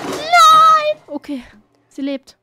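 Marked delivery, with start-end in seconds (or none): 0.1-1.0 very high, loud cry of no; panicked scream. Real, not acted. Streamer watching it nearly fall, panicked scream.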